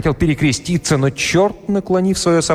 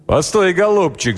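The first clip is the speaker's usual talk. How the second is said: raised pitch, without a full shout; mild cry; 8 semitones higher than usual. A narrator's reading. Blacksmith holding the devil by the tail, crying out mildly. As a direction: call out sharply to stop him. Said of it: "закричал кузнец".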